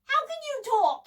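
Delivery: high, loud shout of disbelief; startled boy's shout. A narrator's reading. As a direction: shout the question, voice shooting up. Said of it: "shouted David".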